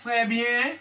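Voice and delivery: ordinary talk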